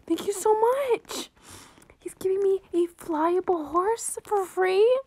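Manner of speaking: high, slow thrilled thanks; high grateful gush. Real, not acted. Player thanking a stranger for a free horse, high gushing thanks. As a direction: gush with gratitude, a little breathy.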